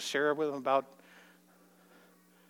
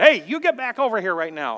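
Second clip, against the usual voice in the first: loud, higher stern command; stern shout; 6 semitones higher, 11 dB louder than usual. Retold or imitated. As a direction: call the boy back sharply. Grandpa calling runaway boy back.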